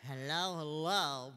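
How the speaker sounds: ventriloquist dummy calls hi; puppet Sam voice. Acted. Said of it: "Sam, why don't you say hey".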